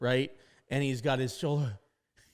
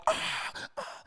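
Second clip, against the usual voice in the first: breathless whisper; 7 dB quieter than usual. Retold or imitated. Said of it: "and he's like".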